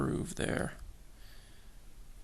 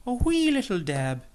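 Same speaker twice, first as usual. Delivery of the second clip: bad Irish accent; 9 semitones higher, 7 dB louder than usual. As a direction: lay on a cheesy Irish lilt for "wee". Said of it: "in my worst Irish stereotype accent".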